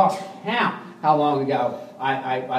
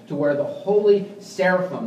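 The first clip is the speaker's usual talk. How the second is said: normal talking voice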